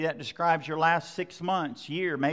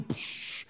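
lightning sound effect; 12 dB quieter than usual. Retold or imitated.

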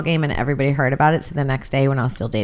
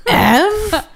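high incredulous outrage; 10 semitones higher, 3 dB louder, much slower than usual. Pretend. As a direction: sound appalled at the letter. Mock outrage at cursive F.

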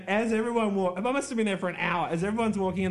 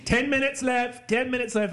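higher called-out announcement, ten minutes left; calling out time; 7 semitones higher than usual. Retold or imitated. Announcer calling time to competitors, a called-out warning. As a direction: announce the time to the whole room.

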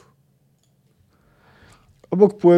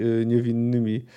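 plain reading voice

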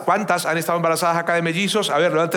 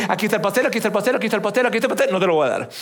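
quick, higher sing-song repetition; teasing taunt; 3 semitones higher, much faster than usual. Retold or imitated. Someone dangling a cake he will never hand over, taunting. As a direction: dangle it fast, then snatch it away.

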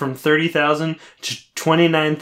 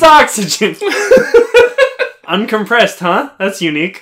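laughing; 9 semitones higher, 7 dB louder than usual. Real, not acted. Laughing mock wonder.

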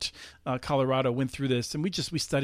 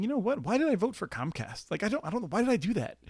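higher-pitched mock puzzled voter; voter's second thoughts; 7 semitones higher than usual. Imagined voter rethinking a Comcast vote, mildly baffled regret. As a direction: lightly voice a voter questioning themselves.

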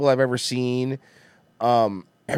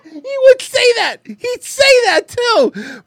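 laughing; 7 dB louder, slower than usual. Real, not acted. Laughing after the joke.

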